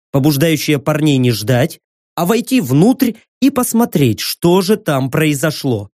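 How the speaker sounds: ordinary delivery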